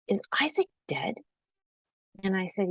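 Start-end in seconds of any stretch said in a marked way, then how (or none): 0.1-1.1 put-on small, breathless whisper of a child; hushed child whisper. Little daughter asking mommy about dead brother, whispered. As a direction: whisper it like a frightened little girl.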